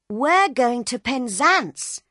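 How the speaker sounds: put-on high, loud chirpy child; excited little girl. A narrator's reading. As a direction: announce it proudly like a kid. Little girl tells Bill.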